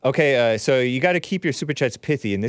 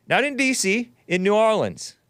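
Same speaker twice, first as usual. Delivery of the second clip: ordinary talk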